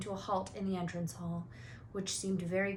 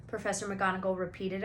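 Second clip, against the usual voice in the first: plain reading voice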